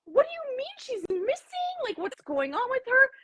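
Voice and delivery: high-pitched flabbergasted disbelief; retold freak-out. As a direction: squeal it, panicking but not shouting. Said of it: "I'm, like, freaking out".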